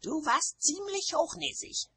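high, peevish witch voice; high-pitched grumble; grumpy. A narrator's reading. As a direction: say it sullenly in a high voice. Nanny continues the quarrel.

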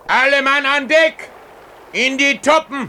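captain's call to deck; calling all hands. Acted. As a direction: bark the order across the deck. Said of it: "erscholl schon wieder sein Ruf".

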